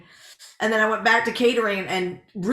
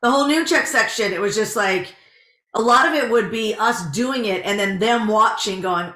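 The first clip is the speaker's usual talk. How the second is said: ordinary talk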